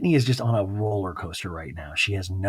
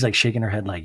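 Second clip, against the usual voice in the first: usual speaking voice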